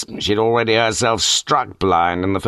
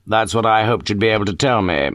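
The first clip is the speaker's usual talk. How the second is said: usual speaking voice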